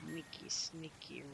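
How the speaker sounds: sneaky whisper. Pretend. Creeping past guards.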